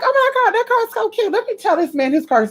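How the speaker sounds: very high, gushing squeal; gushing excitement. Retold or imitated. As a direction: squeal over the cute car. Admiring a Porsche.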